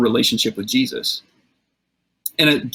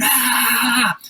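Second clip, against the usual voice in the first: mock scream; 11 semitones higher than usual. Preacher acts out the possessed man yelling and screaming, mock scream. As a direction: imitate a wild man's scream, held back in volume. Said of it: "he's yelling and screaming".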